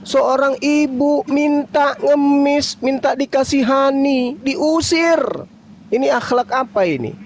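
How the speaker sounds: appalled rhetorical outburst; indignant. Real, not acted. Indignant outburst about begging mother. (nada marah tidak percaya)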